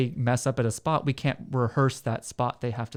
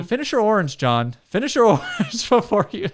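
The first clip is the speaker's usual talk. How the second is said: high, loud scolding voice; loud mock parent voice; 8 semitones higher, 6 dB louder than usual. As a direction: bark it like a nagging parent.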